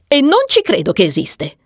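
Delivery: defiant disbelief; brazen. A narrator's reading. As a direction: dismiss it boldly. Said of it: "dichiarò Agnes spavalda".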